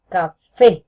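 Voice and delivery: Café with a loud, hard "fé"; stress on final syllable. Shown as a demonstration. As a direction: punch the last syllable of Café. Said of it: "tiene la fuerza en fe".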